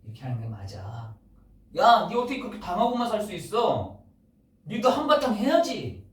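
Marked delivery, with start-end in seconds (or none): 1.8-6.0 egging on. Retold or imitated. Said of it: "세상의 불신자들이 이렇게 하는 것이 옳아".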